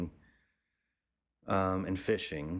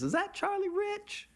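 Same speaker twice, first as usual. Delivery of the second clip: much higher, put-on voice of a matronly small-town woman. Gas station woman spots Charlie, high excited matronly voice. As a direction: squeal it out like an excited country matron. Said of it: "this woman, matronly l-, woman comes out, and she goes".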